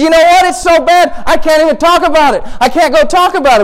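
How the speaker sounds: high-pitched, exasperated outpouring; agitated confession. Acted. Asaph saying he can't voice his doubts, agitated. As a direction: push it up high, overwhelmed by frustration. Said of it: "And he says".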